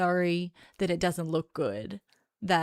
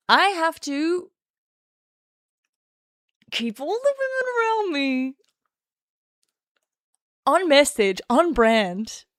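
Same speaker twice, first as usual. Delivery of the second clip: megalomaniac rant; 7 semitones higher, 5 dB louder than usual. Pretend. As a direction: play the power-hungry boss. Mock megalomaniac boast, high.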